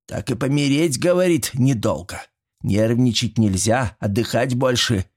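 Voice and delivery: ordinary delivery, nothing marked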